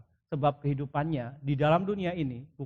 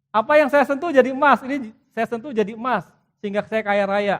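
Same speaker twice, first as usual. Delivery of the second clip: mock greedy wish; 6 semitones higher, 10 dB louder than usual.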